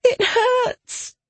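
very high, small whimpering voice. A narrator's reading. Hurting girl in her bonds, whimpering. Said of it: "she whimpered".

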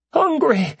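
Hungry! cried out very high; Hungry! burst out in disbelief; astonished joy. Acted. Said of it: "His voice was choked but exhilarated".

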